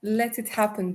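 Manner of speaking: usual speaking voice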